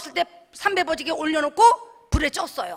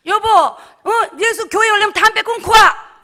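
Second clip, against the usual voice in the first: nagging wife; 4 semitones higher, 7 dB louder than usual. Retold or imitated.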